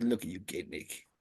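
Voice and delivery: low, hushed contempt; quiet, disgusted put-down; mock disgust. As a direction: imitate someone sneering in disgust, pitched well down and soft. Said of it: "killing the disgust that he had in his voice".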